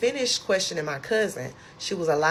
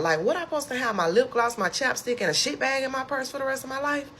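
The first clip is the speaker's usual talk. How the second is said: high, fast indignant rant; reenacted freak-out, pitch held way up; flipping out; 8 semitones higher than usual. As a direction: rattle off the outrage in a raised pitch. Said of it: "I was flipping out".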